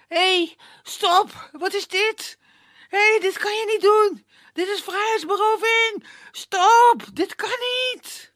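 high, loud panicked protest; panicked cry. Acted. Prince tangled in branches cries out in panic. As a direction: yell in panic as branches grab him. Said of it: "En hij roept".

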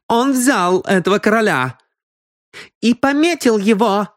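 high-pitched woman's accusing cry. A narrator's reading. Аврора accuses Хэй of marking a king, crying out. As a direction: call it out high and excitedly. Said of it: "крикнула Аврора".

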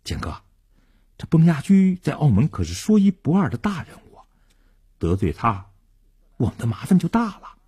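mildly anxious, cautioning voice; fearful warning. A narrator's reading. Underling warning the boss about a powerful rival, worried. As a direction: warn the boss with a touch of worry. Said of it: "感到很害怕".

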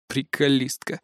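soft, weary resigned mumble; resigned sigh. A narrator's reading. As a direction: sigh it out, giving up. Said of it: "обречённо вздохнул я".

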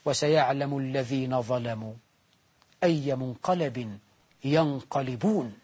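slow, low grave recitation; preacher intoning a verse of warning; solemn verse. Acted. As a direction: let the warning fall slowly and heavily. Solemn verse closing the point.

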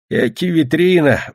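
put-on slow, high old man's exclamation; sighing grandfather. A narrator's reading. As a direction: marvel wearily at the wind, drawn out. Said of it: "вздохнул дед".

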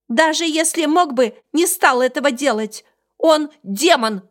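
high, loud angry little beast; shouting creature. Acted. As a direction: yell in a squeaky rage. (кричащий зверёк)

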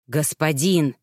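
low, lightly voiced weary dragon; a hint of a sighing soldier appealing; sighed plea. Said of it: "вздохнул один из драконов". (лёгкий усталый вздох дракона, обращённого к господину)

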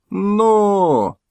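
high shouted urging to the horses; sharp high shout of "Но!" to the horses. Acted. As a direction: crack out a driver's call to the horses.

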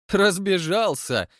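slow, scornful cut-off; mocking interruption. A narrator's reading. Chairman mocking Rastopchin, scornful. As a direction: cut him off with a sneer. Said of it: "прервал его Аристарх Аристархович".

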